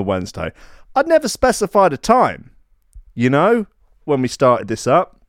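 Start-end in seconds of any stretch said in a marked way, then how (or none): none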